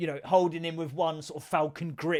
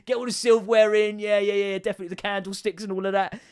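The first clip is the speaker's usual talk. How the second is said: louder, higher eager urging; hammed-up, raised-voice eagerness; mock excited burglar; 5 semitones higher, 5 dB louder than usual. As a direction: egg on an accomplice with pretend glee. Same burglar line, now excited.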